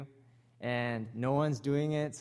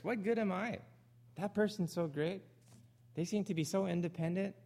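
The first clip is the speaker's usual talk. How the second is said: insecure churchgoer; 6 semitones higher than usual. Retold or imitated. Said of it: "Or maybe it's the reverse".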